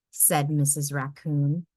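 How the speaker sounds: ordinary delivery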